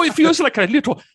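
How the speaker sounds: put-on high, loud Canadian accent gone Irish; bad Canadian accent. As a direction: attempt Canadian and land on Irish leprechaun. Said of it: "trying to do a Canadian accent".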